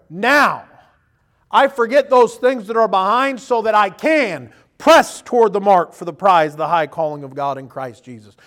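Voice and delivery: loud, slow fervent preaching. Acted. The pastor preaching the verse to the congregation, loud. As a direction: drive the verse home to the church.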